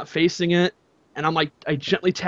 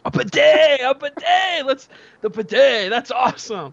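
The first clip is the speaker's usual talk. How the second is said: high, loud, giddy cry of delight; mesmerized excitement; 7 semitones higher, 4 dB louder than usual. Retold or imitated. Retelling finding the bidet, giddy high squeal. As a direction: squeal it like a kid seeing a toy. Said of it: "mesmerized by this".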